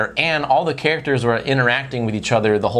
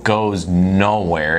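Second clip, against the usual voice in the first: normal talking voice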